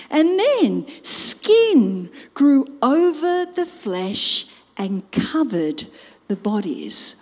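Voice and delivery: ordinary talk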